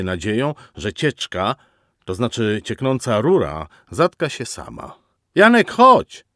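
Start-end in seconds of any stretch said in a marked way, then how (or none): 5.3-6.2 much higher, louder chirpy call; calling Agata. A narrator's reading. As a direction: sing out his name from the kitchen. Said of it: "szczebiotliwy głos Agaty".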